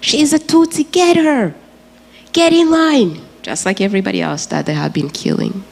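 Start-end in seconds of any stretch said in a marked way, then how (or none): 0.0-3.2 pitched-up yelling of the killers; killers shouting. Retold or imitated. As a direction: bark it out like an angry mob pointing her out. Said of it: "these people started saying".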